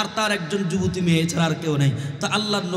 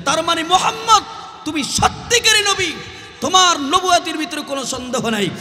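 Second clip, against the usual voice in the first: high, impassioned mock wail; tearful cry, retold; 9 semitones higher, 3 dB louder than usual. Sick old woman tearfully crying out to the Prophet, high wail. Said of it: "চোখের পানি ছেড়ে দিয়ে চিৎকার করে বলে".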